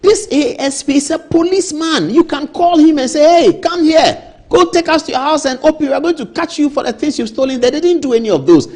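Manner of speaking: high, loud and fired up; a worked-up cry about the raid; agitated outcry. Real, not acted. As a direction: rant it out in outrage. Agitated report of police raid.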